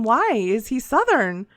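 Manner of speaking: ordinary talk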